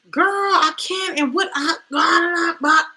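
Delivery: high, loud, hammed-up fretting; mock fretting. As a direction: fuss dramatically, dissolving into babble. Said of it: "After fretting".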